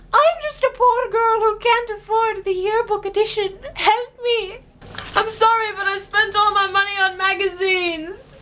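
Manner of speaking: high, pleading whine; mock whiny girl. Teen girl pleading that she cannot afford it, high mock whine. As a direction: ham up the poor-girl self-pity. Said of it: "'cause I was like".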